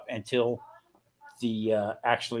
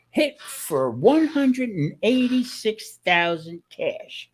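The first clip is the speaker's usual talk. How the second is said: slow, high-pitched drawn-out announcement; dramatic jackpot reveal; 7 semitones higher, much slower than usual. Real, not acted. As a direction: draw out the big number for suspense.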